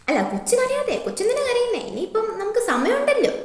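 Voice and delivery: high, loud, fussing teacher; acted alarm, pitch way up; flustered consoling. Teacher flustered by crying pupil.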